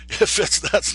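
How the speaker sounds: loud laugh breaking into the words. Real, not acted. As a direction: laugh at your own muddled guess while talking. Laughs at his own uncertain guess.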